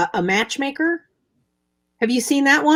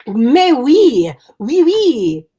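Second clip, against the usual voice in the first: mock French; 5 dB louder, much slower than usual. Mock French in fur hat.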